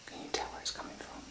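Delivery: tense hushed whisper. Real, not acted. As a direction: whisper urgently, afraid to be heard. Whispering during ghost hunt.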